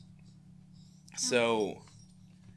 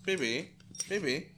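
1 cooing to dog; 7 semitones higher than usual. Real, not acted. Soothing the dog.

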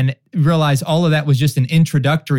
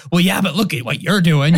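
mildly defensive retort, a little higher; mock snapping back; 3 semitones higher than usual. Spouse acting out a defensive reply to criticism, mock snapping back. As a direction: play-act a quick comeback at a spouse. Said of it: "you just snap back and say".

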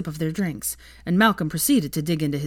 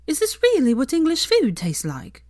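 high, disdainful question; a grimacing foreign critic; disgusted; 9 semitones higher, 3 dB louder than usual. A narrator's reading. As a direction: sniff at the food. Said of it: "grimaced in disgust".

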